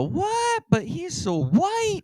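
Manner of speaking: very high-pitched, drawn-out astonishment; hammed-up incredulous reaction; mock disbelief. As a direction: squeal the objection slowly as a stunned listener. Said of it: "Now you go".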